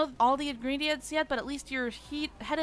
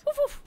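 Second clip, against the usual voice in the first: mock woof. Dog barking.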